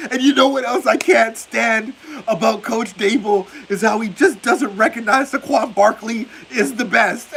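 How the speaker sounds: mock the rant; imitating a ranting fan. Streamer mimicking a raided Giants fan's rant. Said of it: "No wonder he's ranting".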